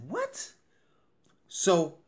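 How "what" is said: a much higher yelp of retold disbelief; incredulous reply to a friend's story; shocked what. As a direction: squeak it in mock shock. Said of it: "I said".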